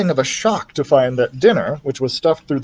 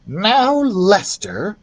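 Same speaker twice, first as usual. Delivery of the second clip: slow, higher, scolding mother; fussing; 8 semitones higher, much slower than usual. Acted. Ralph's mother chides Lester, slow and fussy. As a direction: drawl it as a gentle rebuke. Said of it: "fussed Ralph's mother".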